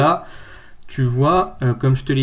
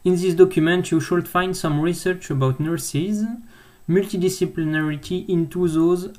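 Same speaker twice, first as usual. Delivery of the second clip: French-accented English, pitched a little higher; self-mocked English accent; 4 semitones higher than usual. Real, not acted. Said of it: "avec mon super accent anglais".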